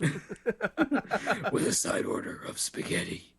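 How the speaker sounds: slow, unpitched hero rasp; Batman. Retold or imitated. Batman adding to his dinner order, slow rasp. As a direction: stretch it out in a mock-grim rasp. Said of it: "The best Batman spoof ever".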